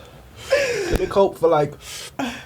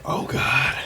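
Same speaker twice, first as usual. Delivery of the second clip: usual speaking voice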